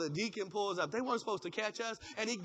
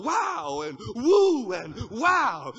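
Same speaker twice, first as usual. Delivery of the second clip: amazed exclamations; 5 semitones higher, 9 dB louder, much slower than usual. Pretend.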